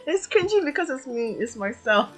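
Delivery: high, loud, self-mocking giggly talk; embarrassed amusement. Real, not acted. Streamer admits own line was cringy, giggling. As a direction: talk through your own embarrassed laughter.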